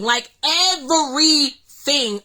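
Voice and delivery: "everything" slowly stretched, each syllable punched; slow, hard emphasis on "everything"; emphatic stress on everything. Real, not acted. Repeats the word for emphasis.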